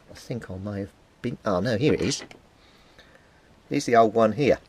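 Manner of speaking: usual speaking voice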